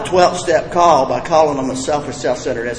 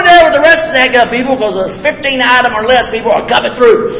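higher, louder, laughing at his own fury; mock indignant rant; 9 semitones higher, 7 dB louder than usual. Speaker to the group, mocking his own rage at a shopper, higher, louder and laughing. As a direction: ham up your old self-righteous anger.

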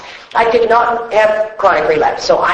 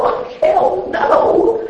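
drawn-out, higher, scoffing "hell no"; mock outraged refusal; 11 semitones higher, much slower than usual. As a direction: scoff at the idea, slowly and high. Retelling his blunt refusal.

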